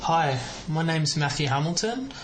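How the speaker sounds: ordinary delivery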